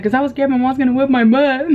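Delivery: a mock child's voice explaining why he faked drowning; scared little boy. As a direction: imitate a scared kid confessing. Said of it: "He's like".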